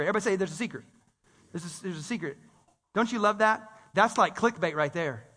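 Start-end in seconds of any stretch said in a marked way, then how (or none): 0.4-2.4 low, soft, as if confiding; hushed secret-sharing. Pretend. Preacher having everybody say there's a secret, hushed. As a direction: let them in on a secret, softly. Prompts congregation to share a secret.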